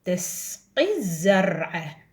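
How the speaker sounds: تسقي الزرع stretched out very slowly; teacher reading very slowly to young pupils; very slow model reading. Shown as a demonstration. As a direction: spell the phrase out at a crawl. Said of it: "إيش بيها".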